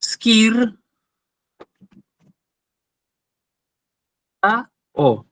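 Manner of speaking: skir, a, o spoken as separate syllables with pauses; slow syllable split of skir, a, o. Shown as a demonstration. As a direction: spell skirtao out by syllables, skir, a, o. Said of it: "si yo lo separo en sílabas".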